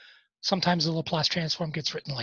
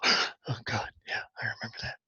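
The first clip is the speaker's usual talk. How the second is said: mock traumatized student; 7 semitones lower, 5 dB quieter, faster than usual. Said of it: "Most people just go".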